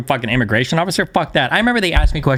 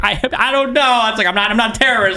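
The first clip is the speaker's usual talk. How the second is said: pitched well up, nervous and defensive; flustered traveller pleading innocence; nervous panicked reply; 6 semitones higher than usual. Retold or imitated. Panicked at airport questioning.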